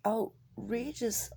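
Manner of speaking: ordinary talk, nothing marked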